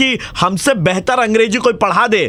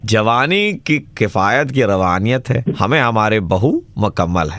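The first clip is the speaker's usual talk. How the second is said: slow, much lower put-on voice of a pompous gentleman; mock pompous gent; 11 semitones lower than usual. Prank caller teasing the angry woman in a slow, much lower pompous voice. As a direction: lay on the fake Urdu formality, slowly.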